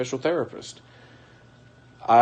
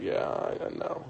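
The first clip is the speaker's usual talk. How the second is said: near-whispered, much lower and softer; hushed resigned reply; 9 semitones lower, 8 dB quieter than usual. Retold or imitated. Husband answering the pastor in a defeated hush. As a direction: barely breathe it out, resigned.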